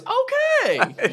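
very high-pitched hype-up squeal; over-the-top excited diner employee; hyping up. Acted. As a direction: hype the order up, pitch soaring. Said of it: "hypes up your meal".

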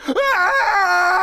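piercing high terrified shriek; blood-curdling scream. Acted. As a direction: scream as something grabs you. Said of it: "blood-curdling scream".

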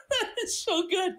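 high laugh into words; laughing through the words; shrieking laughter. Real, not acted. Laughing at goat.

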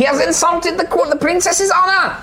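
high tiny outraged voice; indignant squeak of honor; fairy warrior. Acted. Fairy warriors defending the princess's honor, high and outraged.

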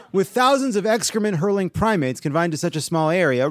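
ordinary talk, nothing marked

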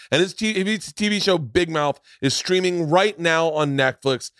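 promo read. Acted. Reads upbeat podcast promo.

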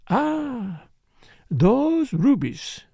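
high sighing "Ah", then very slow; entranced longing. A narrator's reading. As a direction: savour the thought, drawing the words out.

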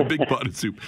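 laughing. Real, not acted. Host laughing back at the caller's soup joke. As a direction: echo the line through a laugh.

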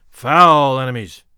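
loud, slow, low-pitched denunciation; crying out. A narrator's reading. Rival warrior denouncing his foe, loud slow cry. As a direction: draw it out with booming contempt. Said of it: "cried Tile".

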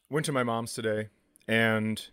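normal talking voice

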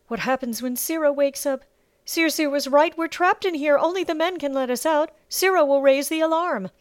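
high, loud, frightened voice; alarmed protest. Acted. Trapped slave girl fears the alarm, voice high with fear. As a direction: argue in rising panic.

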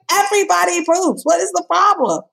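high, incredulous scolding; imitating the doctor's exasperation; exasperated doctor. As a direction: scold like it's no big deal. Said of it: "she was like".

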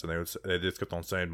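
normal talking voice

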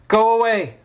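loud, high shout; yelled. Retold or imitated. Alone on the hillside facing the bear, a loud high yell. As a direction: throw it at the bear full force. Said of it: "I yelled again, laughing".

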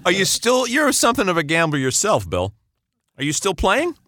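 normal talking voice

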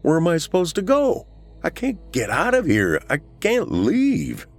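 high-pitched, distressed outburst; desperate protest. A narrator's reading. Ghost Eli protests being told to move on, desperate. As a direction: plead that you are trapped, stretching the last words.

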